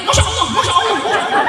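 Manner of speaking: very high, loud squealing "Masya Allah"; awed woman. Acted. Fake Bu Nyai squealing in awe as women kiss her hand. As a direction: squeal it like an overwhelmed fake lady.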